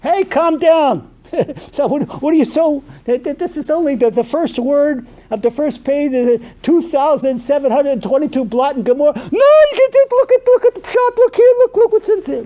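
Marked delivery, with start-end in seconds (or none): 0.0-1.1 loud, slow soothing; slow him down gently; mock calming. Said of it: "calming the excited learner". 9.1-12.4 enthusiastic learner. Retold or imitated. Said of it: "the learner insists excitedly".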